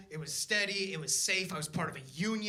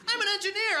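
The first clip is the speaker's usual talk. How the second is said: hammed-up boast; 11 semitones higher, 4 dB louder, faster than usual. Repeated, pitched way up.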